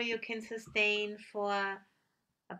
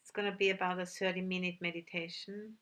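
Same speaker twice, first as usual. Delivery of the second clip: calm meditation guide with long, unhurried pauses; measured, settled voice, leaving silence between instructions; guided meditation. Real, not acted. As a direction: gently lead sitters inward, phrase by phrase.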